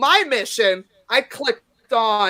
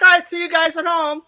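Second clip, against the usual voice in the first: high, loud, joking goodbye; light-hearted send-off; 6 semitones higher, 5 dB louder than usual. Retold or imitated. Comic to ejected hecklers as they leave, joking send-off. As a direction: call it out cheerily as a joke. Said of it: "I lightened it up".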